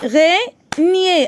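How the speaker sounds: "Araignée" slow, broken into A, rai, gnée; three separate beats on "Araignée"; teacher counting the syllables of "Araignée". Shown as a demonstration. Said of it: "trois syllabes".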